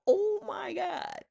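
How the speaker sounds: high, slow drawn-out gasp of alarm; panicked cry. Retold or imitated. Panic as dog chokes.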